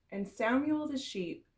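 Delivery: usual speaking voice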